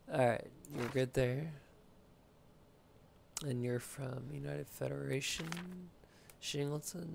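ordinary delivery

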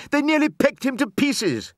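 very high-pitched, frantic wail; distressed cry. A narrator's reading. Mother clutching her screaming baby, crying out. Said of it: "she was crying".

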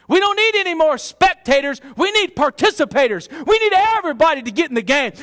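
rally the church like a coach; full force pulpit preaching; fiery preaching. Acted.

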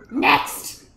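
loud, abrupt call to the queue. Pretend. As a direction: call out sharply for the next person. Calls the next entrant.

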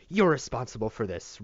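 loud, high shriek of rage; loud furious shriek at the boy. Acted. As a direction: scream the blame at him. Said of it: "Rosa shrieked".

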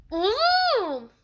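sudden high, loud yell; shocked shout. Real, not acted. Gamer yelling at a shock in the match. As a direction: blurt it out in a loud burst.

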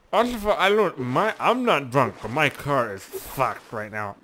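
put-on slow, laboured drunken protest; drunk slur. Shown as a demonstration. Drunk driver protesting to police, slow slurred voice. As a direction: drag out the slurred words, lurching along. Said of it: "That's how you act drunk".

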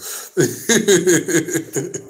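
real giggling laughter; amused laugh. Real, not acted. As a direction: laugh at your own silly bit. Amused at own fake accent.

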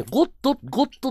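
ゴッド with a clear "o" and final "do"; ゴッド said properly, every sound there; careful GOD pronunciation. Shown as a demonstration. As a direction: pronounce ゴッド in full, high-pitched. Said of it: "ちゃんと言ってんの".

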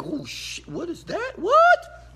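rising to a high, loud amazed "What?"; eyes bugging, hooked; amazed surprise. Pretend. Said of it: "make my eyes bug".

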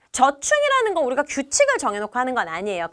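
ordinary talk

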